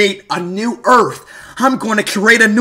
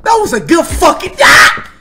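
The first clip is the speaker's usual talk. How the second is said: anguished yell; 6 semitones higher than usual. Real, not acted. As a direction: yell as the run ends. Frustrated cry after dying.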